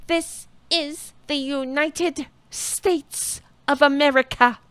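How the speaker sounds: slow, every syllable of "United States of America" drawn out high; mock Republican over-enunciation, stretched and pompous. Mocking Boebert-style politicians, slow pompous over-enunciation. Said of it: "over-enunciating".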